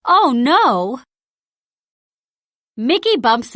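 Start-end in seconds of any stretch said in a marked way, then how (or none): none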